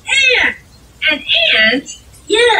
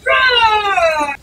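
dinosaur roar; 3 semitones higher than usual. Pretend. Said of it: "I'm a dinosaur".

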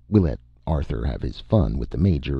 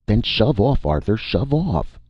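high-pitched, insistent command; urgent order; 8 semitones higher than usual. A narrator's reading. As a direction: urge him off, voice raised. Vern urgently orders Arthur away.

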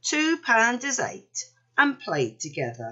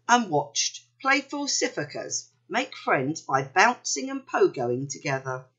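ordinary delivery

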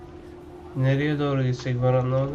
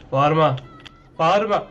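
loud, raised "Bağırma" at a yelling character; shouting back; 5 dB louder than usual. Real, not acted. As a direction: snap back loudly to stop the shouting. Telling shouting NPC to stop. (yüksek sesle bağırarak)

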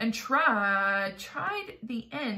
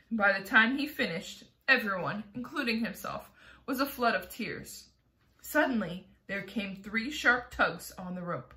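plain reading voice

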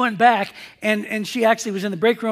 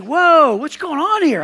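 loud, high, retold shock; startled; 5 semitones higher, 7 dB louder, slower than usual. Man dodging a surprise kiss, acted startled alarm. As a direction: act out being caught off guard.

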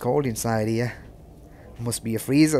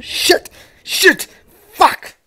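sharp, loud, high swearing; shouted curses; 11 dB louder, much slower than usual. Real, not acted. Player just caught by the monster, loud curses. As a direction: bark out each curse in alarm.

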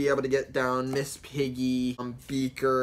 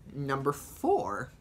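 ordinary talk

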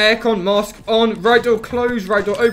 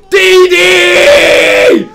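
high, loud yell of the name; panicked yell; 12 semitones higher, 15 dB louder than usual. Real, not acted. Player spotting D D, high alarmed yell. Enemy appears suddenly.

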